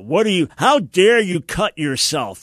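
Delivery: hammed-up furious parent snapping at a child; angry scolding. Mock angry God rebuking a girl who cuts herself, loud outrage.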